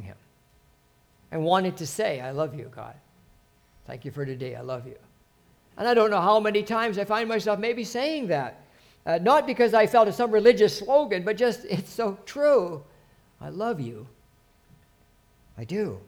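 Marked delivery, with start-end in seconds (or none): 2.2-5.0 much softer and lower, a private whisper-like prayer; hushed loving prayer under the breath; tender murmured prayer. Retold or imitated. Retold prayer to God. 13.4-16.0 quiet heartfelt affirmation; soft sincere confession. Real, not acted.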